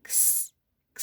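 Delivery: "क्स" as k running into s, no vowel; X letter sound. Shown as a demonstration. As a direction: softly sound out "क्स" as a bare ks. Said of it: "एक्स का sound".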